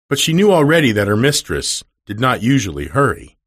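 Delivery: ordinary delivery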